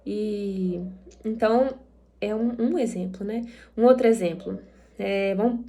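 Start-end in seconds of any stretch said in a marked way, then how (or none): none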